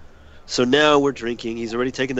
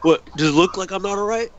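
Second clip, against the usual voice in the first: put-on higher-pitched drunk challenge, quickening; retold belligerence; 7 semitones higher, faster than usual. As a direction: imitate a friend picking a fight. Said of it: "already wants to start a fight".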